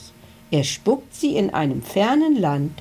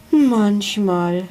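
sad sigh; 3 semitones higher, 4 dB louder than usual. Acted. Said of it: "seufzte er traurig".

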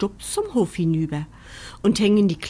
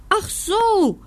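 hohes, lautes Ach so; blökend; 12 semitones higher, 5 dB louder than usual. A narrator's reading. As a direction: wie ein Lamm blöken. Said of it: "blökt Locke". (blökendes Lamm)

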